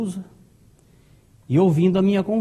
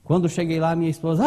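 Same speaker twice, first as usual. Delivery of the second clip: usual speaking voice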